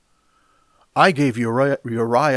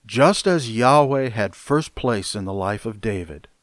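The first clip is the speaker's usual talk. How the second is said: ordinary talk, nothing marked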